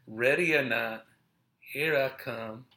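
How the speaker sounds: usual speaking voice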